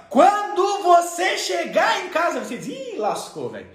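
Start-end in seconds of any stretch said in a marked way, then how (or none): 0.1-2.4 high, drawn-out parental warning; threatening mother. Acted. Mother warning her small child, slow high threat. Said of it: "quando a mãe falava". 2.7-3.7 dismayed aside. Acted. Child's dread at the threat.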